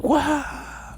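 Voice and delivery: long high-pitched wow; awestruck. Retold or imitated. Angler seeing the landed tuna, high awestruck voice. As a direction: draw it out high in acted amazement. Acting out awe at the fish.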